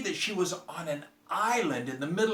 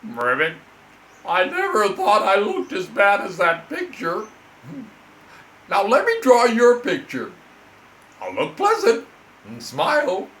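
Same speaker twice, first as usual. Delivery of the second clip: loud, higher, dramatic frog complaint; lamenting frog; 5 semitones higher, 9 dB louder than usual. Acted. Frog complaining to the girl, loud and dramatic. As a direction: wail it like a theatrical frog.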